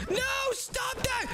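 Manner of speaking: high, stretched scream to stop; panicked shout. Acted. Child stopping dad breaking TV, panicked shout.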